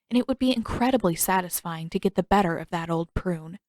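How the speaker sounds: ordinary delivery, nothing marked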